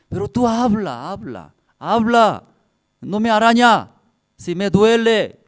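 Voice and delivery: put-on father drilling his child to speak up; emphatic coaching. Acted father coaching daughter to protest.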